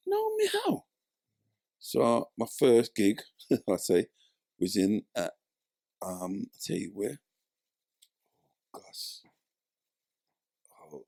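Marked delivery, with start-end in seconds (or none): 0.1-0.9 incredulous. Retold or imitated. Incredulous reply to foundation. 8.7-11.0 whispered to himself, searching for a name; a whispered aside while forgetting the place. Real, not acted. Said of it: "You're gonna have to help me".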